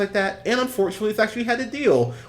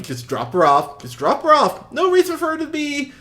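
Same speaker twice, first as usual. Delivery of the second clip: louder, higher, insistent pleading; urging the screen; 10 semitones higher, 6 dB louder than usual. Retold or imitated. Watching the movie, urging the friend to take her home, insistent. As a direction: push hard, willing her friend to drive away.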